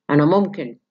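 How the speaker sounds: sudden loud burst at ordinary pitch. Shown as a demonstration. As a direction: demonstrate volume without raising pitch. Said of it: "لاود".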